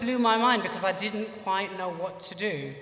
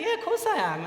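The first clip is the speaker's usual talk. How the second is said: put-on much higher, offhand American accent; 12 semitones higher, faster than usual. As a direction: shrug it off in a light American accent. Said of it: "he spoke a bit with an American accent".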